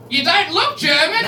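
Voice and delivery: high, loud cop-lady voice; hammed-up imitation of a suspicious officer; mock cop lady. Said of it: "And she was like".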